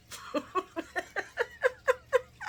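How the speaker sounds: long high giggling laugh; helpless high laughter, trailing on. Real, not acted. Laughing at her own crude remark, high giggles.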